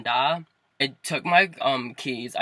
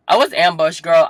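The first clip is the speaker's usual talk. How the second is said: loud, higher outburst; indignant exclamation; 3 semitones higher, 11 dB louder than usual. Real, not acted. Telling viewers about the parents' ambush, indignant. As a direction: exclaim it in dramatic outrage.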